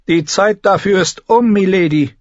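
high, loud character voice; stern healer delivering bad news; blunt healer woman. A narrator's reading. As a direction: pronounce a grim verdict without softening it.

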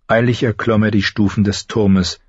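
ordinary delivery, nothing marked

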